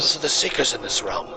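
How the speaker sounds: secretive, half-whispered dwarf; dwarf's hushed warning. Acted. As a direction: warn them under your breath, in character.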